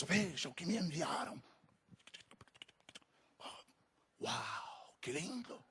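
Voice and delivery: soft murmur to a pew neighbour; hushed aside. Acted. Churchgoer showing a neighbour an email during service, hushed admiring aside.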